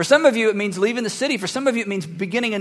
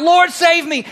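high, loud cry for help; cried out; 9 semitones higher, 6 dB louder, slower than usual. Retold or imitated. Peter sinking in the water cries to the Lord. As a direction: call out as if sinking. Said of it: "he cried out".

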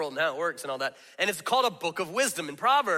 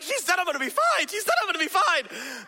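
put-on high, strained repeating of a child in trouble; cling to her promise in a high frantic voice; a little boy panicking in class, high and pleading; panicked child; 12 semitones higher than usual.

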